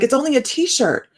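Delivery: hammed-up shout of disbelief; retold yelling. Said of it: "I started screaming at these guys".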